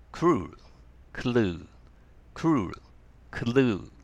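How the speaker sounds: Crew with R after the k, clue with L after the k; R and L minimal pairs. Shown as a demonstration. Teacher drilling crew and clue. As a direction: make the cluster clear. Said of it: "はい、一緒に。".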